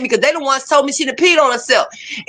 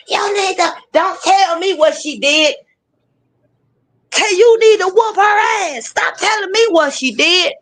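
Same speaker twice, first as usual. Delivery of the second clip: nagging mother; 6 semitones higher than usual. Retold or imitated. Mother nagging him to spank.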